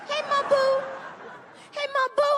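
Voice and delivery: put-on high, bright little-girl call; child's greeting. Daughter greeting her dad, high childlike call. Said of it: "Now it's just Mom Boo".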